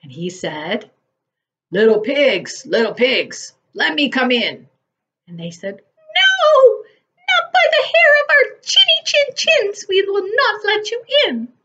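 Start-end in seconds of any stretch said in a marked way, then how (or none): 1.7-4.7 wolf calling. A narrator's reading. Wolf calls at door. 5.8-11.5 very high, loud piglet refusal; little pig refusing the wolf, high and loud; high squeaky pig. A narrator's reading.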